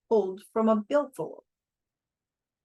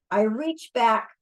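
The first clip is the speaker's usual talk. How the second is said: ordinary delivery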